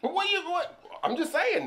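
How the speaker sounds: high protest. Real, not acted. Caught out, defensive protest.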